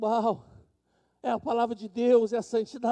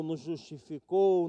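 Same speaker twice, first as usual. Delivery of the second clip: usual speaking voice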